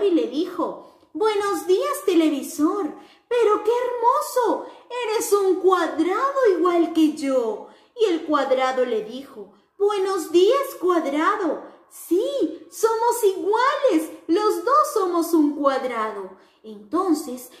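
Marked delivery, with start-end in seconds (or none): 1.1-7.7 high, bright-eyed little square voice; sing-song greeting for children; cheerful square character. Acted. The square happily greets its friends in a high cheerful voice. 9.8-16.4 high, bright-eyed little square voice; sing-song greeting for children; cheerful square character. Acted. The square happily greets its friends in a high cheerful voice.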